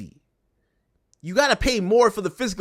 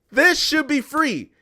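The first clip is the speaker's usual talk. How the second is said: higher and slow, every word weighed; slow emphatic insistence; 6 semitones higher, slower than usual. Acted. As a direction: spell it out slowly as if obvious. Repeat right after, slowed down.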